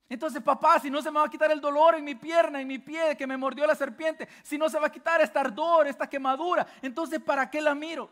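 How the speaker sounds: más agudo y fuerte, como niño que se queja; hijo que pregunta. Acted. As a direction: pregunta del niño dolido, reclamando. Hijo pregunta al papá por el dolor. (el hijo mordido le reclama al papá, quejoso)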